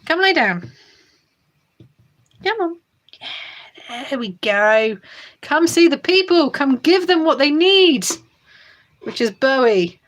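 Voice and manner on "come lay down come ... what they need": high cooing pet talk. Real, not acted. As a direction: coo up high at a beloved cat. Coaxing cat in pet voice.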